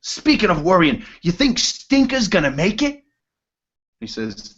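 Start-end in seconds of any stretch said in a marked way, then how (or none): 0.0-3.0 high character voice, rising question; dwarf henchman's voice. Acted. Henchman asks boss about Stinker, high character voice. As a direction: pitch it up high to a questioning end. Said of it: "And says".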